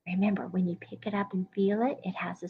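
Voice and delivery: soft whispered reminder. Real, not acted. Teacher whispers a tip to young students, hushed. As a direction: lean in and whisper it quickly.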